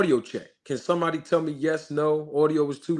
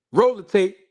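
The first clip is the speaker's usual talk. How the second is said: louder higher announcer cue; 5 semitones higher, 6 dB louder than usual. Real, not acted. Host cues his crew to play the clip, loud announcer call. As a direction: call out roll the tape like a show host.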